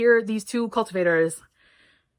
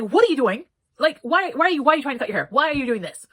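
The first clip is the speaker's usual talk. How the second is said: higher, exasperated telling-off; mock scolding; 4 semitones higher, 4 dB louder than usual. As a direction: scold in rapid repeated whys, pitched up. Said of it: "he gets scolded".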